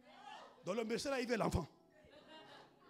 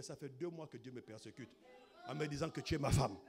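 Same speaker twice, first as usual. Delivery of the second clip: suitor on phone, imitated; 6 semitones lower than usual. Voix du monsieur au téléphone. (imitation grave et posée du prétendant)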